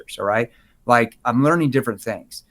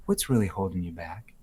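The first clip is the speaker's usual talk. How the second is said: much softer, lower, caring question; concerned tone; 6 semitones lower, 10 dB quieter than usual. Shown as a demonstration. Agent leaning in to a hesitant seller, concerned and soft. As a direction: lean in and ask with real concern. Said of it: "Look at my hand on my chest".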